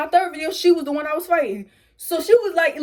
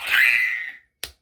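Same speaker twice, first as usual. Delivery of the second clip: breathless laughing shriek; mock outraged laughing shriek; 14 semitones lower than usual. Laughing scream reaction.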